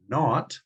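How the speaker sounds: "not" stressed and set apart. Shown as a demonstration. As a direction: lean firmly on the word "not". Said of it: "He's gonna stress words like".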